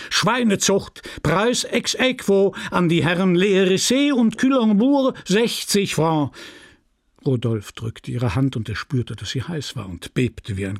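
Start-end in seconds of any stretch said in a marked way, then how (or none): 0.1-6.4 high official proclamation, slowing on the sum; chairman calling out. Acted. Chairman awards the pig-breeding prize to two gentlemen, calling out. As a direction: read out the award to the crowd.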